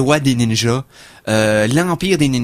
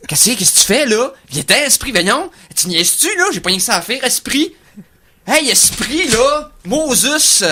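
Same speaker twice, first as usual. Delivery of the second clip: arrogant seance caller; 10 semitones higher than usual. Retold or imitated. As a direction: talk down to the spirit like a toddler. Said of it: "d'y parler comme si c'est un enfant de quatre ans".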